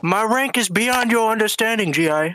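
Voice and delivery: put-on Vietnamese accent. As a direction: play a Viet Cong officer with a Vietnamese accent.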